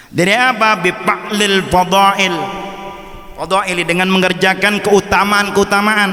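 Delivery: high, measured reading-out voice; kitab recitation. Acted. Recites kitab text and translates.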